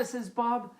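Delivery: usual speaking voice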